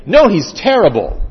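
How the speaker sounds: loud, emphatic acted denunciation; mock outspoken put-down; blunt condemnation. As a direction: condemn him outright, loudly. Acting out blunt slander.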